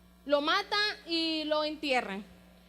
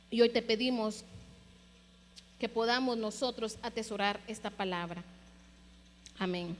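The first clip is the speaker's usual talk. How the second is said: closing prayer. Acted. Pastor praying for the congregation, hushed and devout. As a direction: pray to the Lord, letting the voice drop away.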